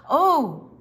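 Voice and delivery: loud startled gasp. A narrator's reading. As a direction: jolt awake with surprise. Dad wakes startled.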